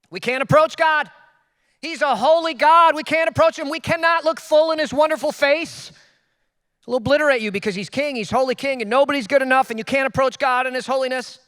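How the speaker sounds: insistent, high and forceful declaration; voiced legalist. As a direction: preach it like an alarmed legalist. Voicing legalistic churchgoers' view.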